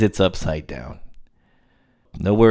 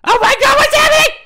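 high panicked screaming, mock terror; hyperventilating; 7 dB louder, faster than usual.

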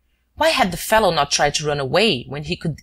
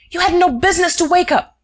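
high, loud angry scolding; 5 dB louder than usual. Acted. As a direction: hammer the orders home. Shears scolding Wilson.

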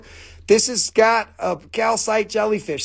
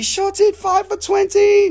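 street hawker call; 13 semitones higher, 4 dB louder than usual. Pretend. Hawking like a street vendor.

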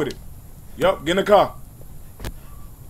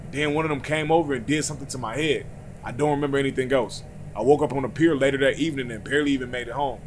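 lower, softer storytelling read; hushed reading of a creepy note; reading a note aloud; 5 semitones lower, 9 dB quieter than usual. Acted. Reads alien abduction note.

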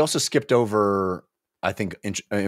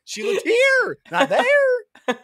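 higher and higher, taunting sing-song; gleeful creepy voice. Pretend. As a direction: gloat like a sneaky imp. Voicing the possessed character.

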